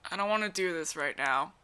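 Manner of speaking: low, soft monotone; Noise. Acted. Showing co-reader Noise's monotone, low and soft. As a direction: drone it low and bored. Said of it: "he's very monotone kind of person".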